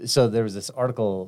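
ordinary delivery

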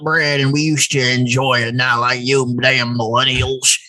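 put-on slow cranky old-timer grumble; Buck Flowers voice. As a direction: grumble like a grumpy grandpa, slowly. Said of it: "just doing that Buck Flowers voice".

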